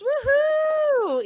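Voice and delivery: high whooping celebration; excited cheer. Real, not acted. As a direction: cheer loudly at the milestone. Celebrating thirty likes.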